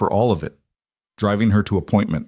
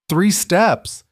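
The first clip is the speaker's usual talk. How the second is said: joyful cry; 10 semitones higher than usual. A narrator's reading. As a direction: shout it out in delight. Husband cheers her first steps.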